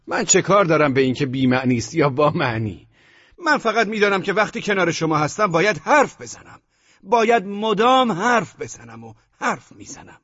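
high, feverishly insistent voice; great agitation. A narrator's reading. Desperate lover insists he must talk, greatly agitated. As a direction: pour it out high and agitated, slowing at the end. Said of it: "با هیجان بسیار گفتم".

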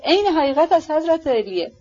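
a touch of a humble servant's vow; firm yet abashed. A narrator's reading. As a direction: swear it, hand on heart, embarrassed. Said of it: "با لحنی محکم و بی تزلزل با خجالت".

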